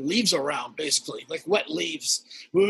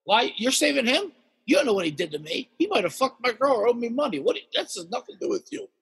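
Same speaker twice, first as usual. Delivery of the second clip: much higher, fast, mock pissed raccoon; angry raccoon voice; 5 semitones higher, faster than usual. Big raccoon telling off the neighbor, high and fast. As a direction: voice the furious raccoon. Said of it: "He's like".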